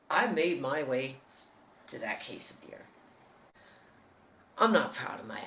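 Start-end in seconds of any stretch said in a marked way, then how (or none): 1.9-2.8 sneaky whisper. Real, not acted. Sneaking toward the beer.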